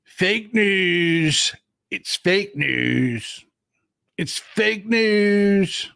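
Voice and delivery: drawn-out mocking fake-news catchphrase; amateur, drawling politician impression; Donald Trump. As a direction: stretch the words out long and slow in imitation. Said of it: "I've gotta work on my impression".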